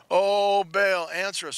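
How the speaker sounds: high, loud mock chant to a god; crying out. Preacher imitates Baal's prophets pleading for an answer, crying out. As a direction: call out like a worshipper begging Baal. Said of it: "crying out".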